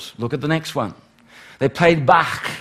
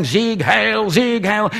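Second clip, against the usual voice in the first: raised, higher-pitched mock rally chant; mock screamed tirade; 6 semitones higher, slower than usual. Preacher imitating Hitler in the film clips, mock screamed tirade. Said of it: "screaming out his tirades".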